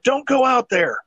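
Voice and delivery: high-pitched frightened warning; scared plea. Retold or imitated. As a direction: voice a panicked girl begging. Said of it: "the daughter's like".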